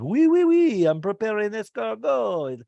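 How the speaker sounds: high, hammed-up French accent with loud oui; playful French chef impression. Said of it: "he's talking French".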